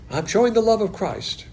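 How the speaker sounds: high-pitched mock-pious boast; mock piety. Preacher mimicking believers proud of small kindnesses, mock piety. Mocks do-gooders' boast of charity.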